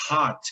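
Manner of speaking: "hot" opening on an aspirated H; "hot" with an airy, throat-made H; carioca-like H. Shown as a demonstration. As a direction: start "hot" on a breath, like a Rio R. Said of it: "aquele som do R do carioca".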